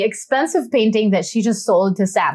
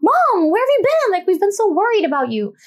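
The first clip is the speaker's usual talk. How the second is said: high, fussing family voices; mock-worried family chorus, pitched well up; 12 semitones higher than usual. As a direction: squeal it like the worried kids. Family greeting drunk mom.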